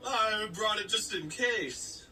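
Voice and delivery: slow, high false modesty; mock coyness. Guitar kid explaining to parents, slow and coy. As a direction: drag it out as a coy show-off.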